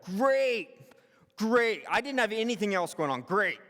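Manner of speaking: loud sarcastic "great", falling each time. Retold or imitated. Employee asked to stay late, loudly sarcastic.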